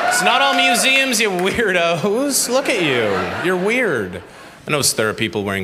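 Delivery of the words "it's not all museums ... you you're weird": high, slow mock indignation; mock indignant. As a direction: stretch it out, high and huffy. Teasing the audience.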